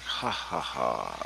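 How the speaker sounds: imitating scoffers with a spoken ha-ha; fake, mocking laugh, falling in pitch; mock laughter. Imitating everybody laughing at old red dots, mock laughter. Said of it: "everybody laughs at 'em".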